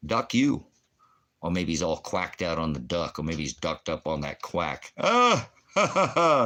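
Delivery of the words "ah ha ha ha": big high cackle at his own pun; loud laugh. Real, not acted. Laughing at his own Oregon pun, loudly.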